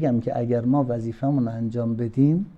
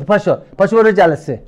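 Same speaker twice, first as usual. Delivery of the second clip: father urging loudly; 6 semitones higher, 8 dB louder than usual. Retold or imitated.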